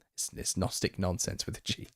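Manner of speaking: whispered jab at Gnostic nonsense; whispered aside. Real, not acted. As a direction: hush it like a sly aside. Muttered jab after rant.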